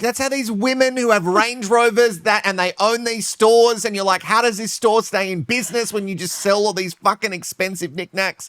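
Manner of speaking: high posh Prahran tone, lightly mocked; lightly hammed-up posh Melbourne accent; posh Melbourne talk. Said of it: "And yet this is how they talk".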